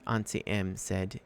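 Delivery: usual speaking voice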